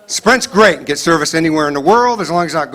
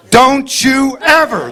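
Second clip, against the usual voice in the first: slow, much higher, drawn-out mock scolding; parent scolding; 9 semitones higher, much slower than usual. Parent scolding child word by word, slow and drawn out. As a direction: stretch each syllable like an angry parent counting them out.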